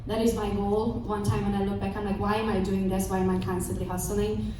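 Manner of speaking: ordinary delivery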